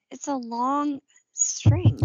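long stretched slowly; stress on long. Acted. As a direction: drawl out the word long. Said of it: "Well, it's".

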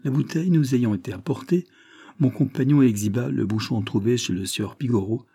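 plain reading voice

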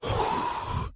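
exasperated sigh; sharp exhale. A narrator's reading. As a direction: blow out a short, sharp breath. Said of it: "زفر المدير في حدة". (زفرة حادة)

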